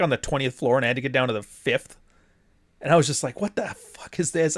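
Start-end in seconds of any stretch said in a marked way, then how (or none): 3.4-4.6 retold annoyed outburst, pitched up; half-mock irritation at the elevator; annoyed exclaim. Stuck in a slow Shabbat elevator, an annoyed retold outburst. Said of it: "I was so annoyed".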